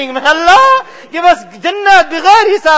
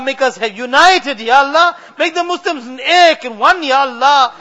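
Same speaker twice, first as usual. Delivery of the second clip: usual speaking voice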